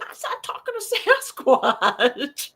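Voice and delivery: laughing. Retold or imitated.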